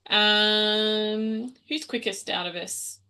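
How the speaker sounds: ordinary talk, nothing marked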